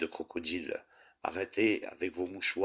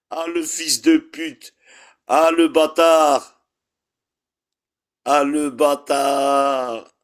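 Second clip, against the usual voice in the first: slow, much higher and louder bellowed curses; drawn-out furious yell; 8 semitones higher, 9 dB louder, slower than usual. Real, not acted. Streamer cursing the troll he made admin, slow furious yell. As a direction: stretch each insult into a shout.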